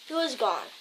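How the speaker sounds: usual speaking voice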